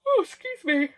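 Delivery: words stretched through a big yawn. Real, not acted. Filming her journal, she yawns and excuses herself. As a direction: yawn mid-sentence, then apologise. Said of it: "You're yawning".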